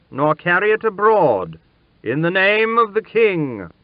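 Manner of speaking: loud, high herald's cry; solemn proclamation. Acted. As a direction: proclaim it to the whole palace. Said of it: "making solemn proclamation".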